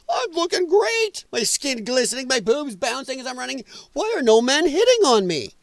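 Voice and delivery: high-pitched, hammed-up girl voice; mock complaining girl. Vlogger mocking gym girls who want attention, high complaining parody. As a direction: parody a preening girl's high whine. Acts out girls bitching about men.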